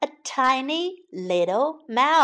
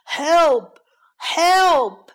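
roaring for help; 6 semitones higher, 8 dB louder than usual. A narrator's reading. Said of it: "狮子吼叫着".

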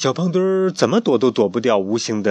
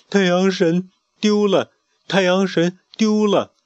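slow, higher dreamy mumbling; sleep talking; 8 semitones higher, 3 dB louder than usual. Acted. Chick talking in his sleep, slow dreamy repetition. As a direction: murmur it in a restless dream. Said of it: "说着梦话".

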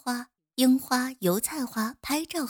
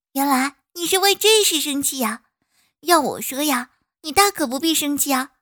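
loud, high, cheerful bee; cheerful little bee reassuring; 4 semitones higher, 6 dB louder than usual. Acted. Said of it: "蜜蜂说".

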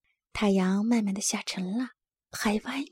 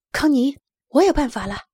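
louder, lightly excited announcement; cheerful; 3 semitones higher, 6 dB louder than usual. A narrator's reading. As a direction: share your idea brightly, without laughing. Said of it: "拉尔斯笑着说". (带着笑意，略显兴奋地大声说)

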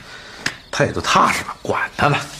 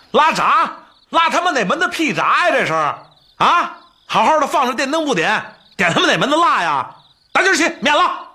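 indignant high-pitched ranting; furious, cursing the blackout; angry; 10 semitones higher than usual. Acted. Angry outburst about power cut.

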